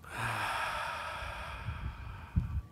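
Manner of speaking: very long, airy breath out; meditative exhale. Real, not acted. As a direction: let the breath go slowly and fully.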